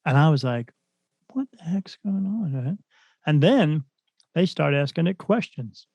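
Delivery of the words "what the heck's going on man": hushed whisper, voiceless; spooked whisper. Retold or imitated. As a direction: whisper it so the spirits won't hear. Spooked by spirit box words.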